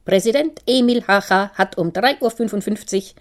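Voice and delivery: radio newsreader repeating the bulletin, stressing drei; radio announcer repeating. Acted. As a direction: announce it again, hitting drei. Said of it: "wiederholte der Sprecher".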